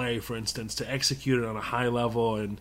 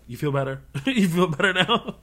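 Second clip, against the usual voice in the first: teasing laugh; 5 semitones higher than usual. Retold or imitated. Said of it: "I'm like".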